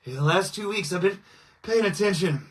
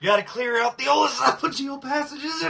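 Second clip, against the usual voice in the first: ordinary delivery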